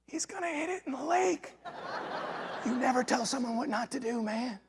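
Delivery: high, squeezed, very soft voice; hushed gleeful aside. Retold or imitated. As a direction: confide it so the rivals can't hear. Secret aside to son.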